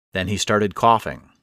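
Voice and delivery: ordinary delivery, nothing marked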